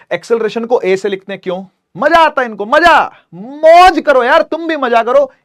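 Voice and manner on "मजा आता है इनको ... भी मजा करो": louder and higher, relishing the word मजा; mock-celebrate the fun of notation; playful excitement. Real, not acted. Teacher joking to students about symbols, excited and playful.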